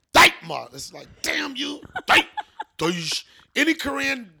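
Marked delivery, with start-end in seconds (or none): 0.1-0.7 loud, punched scolding "dai ma"; curse-like inflection. Shown as a demonstration. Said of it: "It's an inflection in your voice". 1.2-3.2 higher, exclaimed mock scolding; curse-like inflection. Shown as a demonstration. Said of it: "It's an inflection in your voice".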